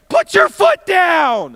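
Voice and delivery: high, slow, loud acted command; shouted order. Mark ordering his buddy on the train, loud shouted command. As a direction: yell each word drawn out.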